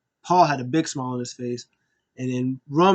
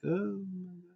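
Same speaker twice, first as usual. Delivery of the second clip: mock gasp; 4 semitones higher, 12 dB quieter than usual. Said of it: "Paul Heyman was like".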